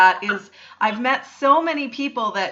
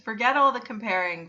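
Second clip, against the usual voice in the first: ordinary talk, nothing marked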